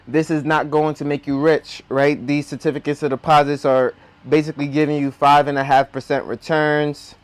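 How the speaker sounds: ordinary delivery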